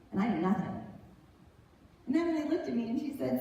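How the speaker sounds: ordinary delivery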